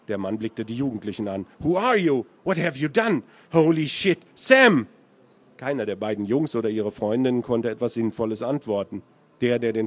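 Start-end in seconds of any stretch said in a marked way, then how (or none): 1.6-4.9 raised, accusing American English; American English, demanding answers. A narrator's reading. American confronting the teenagers, raised American English. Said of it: "in breitem Amerikanisch".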